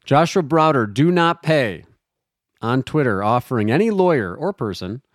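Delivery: ordinary talk, nothing marked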